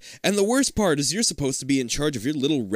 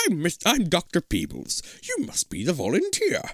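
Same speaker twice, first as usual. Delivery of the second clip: raised put-on scientist voice; eccentric Doctor Peebles; 4 semitones higher than usual. Scientist greeting a supposed volunteer, eager. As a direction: declare it in an odd character voice.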